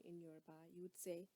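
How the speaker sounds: ordinary delivery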